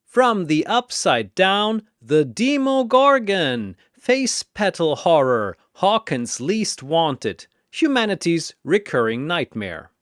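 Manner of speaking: high, loud fight-night intro; boxing announcer calling fighters; arena announcer. Acted. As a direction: hype each fighter like a ring announcer.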